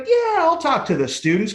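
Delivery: high, cocky imitation of his younger self; big-ego bravado. Newly sober speaker recalls agreeing to address students, big-ego bravado. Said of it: "I'm big ego back then".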